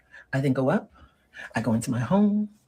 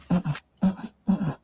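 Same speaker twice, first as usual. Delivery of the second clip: hummed no; 4 semitones higher, much slower than usual. Acted. Humming refusal.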